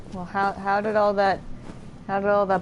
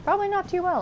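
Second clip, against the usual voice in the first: high wry aside; 11 semitones higher, faster than usual. Real, not acted. Wry guess about villagers.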